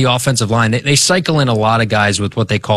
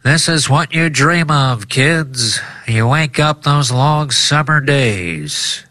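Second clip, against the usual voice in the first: put-on high, lilting old-timey broadcaster; old radio announcer; 4 semitones higher, slower than usual. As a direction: wax nostalgic like a vintage radio caller. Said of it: "I'm gonna do an old-timey radio voice".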